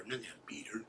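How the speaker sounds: hushed whispered aside; whispered aside about his wife. Real, not acted. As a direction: drop to a whisper, half confiding.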